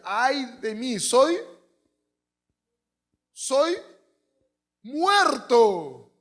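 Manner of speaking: high, loud cry stretched out; dramatic outcry. Acted. Preacher crying the prophet's lament, high and loud. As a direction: let the cry break at the top.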